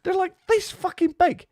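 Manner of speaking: big emphatic claim, voice jumping high; wide-eyed exaggeration; excited exaggeration. Real, not acted. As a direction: pitch shoots way up on this. Exaggerating horse teeth.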